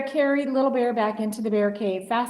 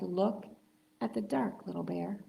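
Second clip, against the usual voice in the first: much softer, hushed and tender; soothing big bear; 8 dB quieter than usual. Acted. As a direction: soothe the cub you're holding. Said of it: "cuddled him and said".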